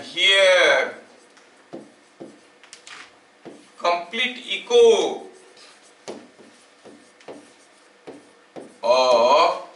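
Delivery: slow, deliberate dictation pace for note-taking. Acted. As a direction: read each word out slowly so students can write it down. Dictating a question to students. (धीरे-धीरे लिखवाने वाला अंदाज़)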